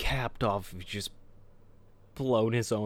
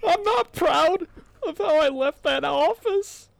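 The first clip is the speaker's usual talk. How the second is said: hammed-up tearful whine; mock crying voice; 5 dB louder than usual. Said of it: "read these ... in your best crying voice".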